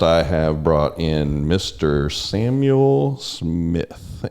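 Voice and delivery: drawn-out, slow announcer-style intro of the guest; slow host introduction. Acted. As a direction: present the guest slowly like a showman.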